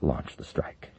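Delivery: breathless, whispered order; barely getting the words out; weakly. Acted. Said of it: "the president said weakly".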